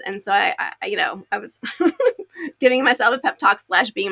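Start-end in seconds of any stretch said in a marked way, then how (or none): 1.6-2.5 laugh. Real, not acted. Laughing at herself.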